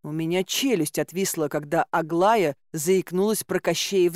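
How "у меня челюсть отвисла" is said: ordinary delivery, nothing marked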